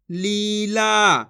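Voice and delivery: लीला very slow, both vowels held; syllables drawn out. Shown as a demonstration. Teacher joins the syllables, लीला drawn out. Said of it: "जिस तरीके से मैं खींच खींच के पढ़ रहा हूँ".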